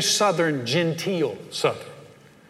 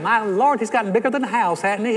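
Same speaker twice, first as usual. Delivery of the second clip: higher-pitched Southern gossip; gossiping Southerner; 5 semitones higher, 4 dB louder than usual. Retold or imitated. Southerner talking about someone who just walked away, mock-scandalized gossip. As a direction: gossip in mock horror once they're gone. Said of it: "As soon as they walk away".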